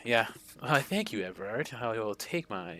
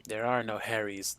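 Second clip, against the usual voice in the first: usual speaking voice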